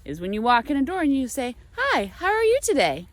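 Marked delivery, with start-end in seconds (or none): 1.8-3.1 chipper greeting. Retold or imitated. Mimics American greeting.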